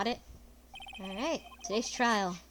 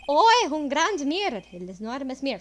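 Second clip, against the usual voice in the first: put-on French accent; 2 semitones higher, 7 dB louder than usual. Streamer voicing the chef's testimony in French accent. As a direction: play the chef with a thick French accent. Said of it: "time for the accent".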